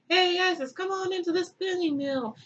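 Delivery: mock friendly coaxing. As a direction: lure someone in with fake friendliness. Set luring Isis, acted.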